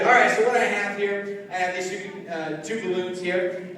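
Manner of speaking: usual speaking voice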